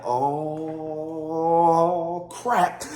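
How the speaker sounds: long held groaning oh, then the word; mock stunned realisation; acted dismay. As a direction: stretch the first word out in dawning alarm. Couple shocked at seeing blood.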